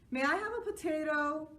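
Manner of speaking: put-on high eager boy's question; child voice. A narrator's reading. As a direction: ask it like an eager kid, high. Joe asks.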